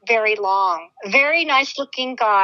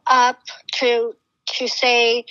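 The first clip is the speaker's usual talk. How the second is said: ordinary talk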